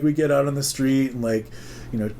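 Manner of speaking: usual speaking voice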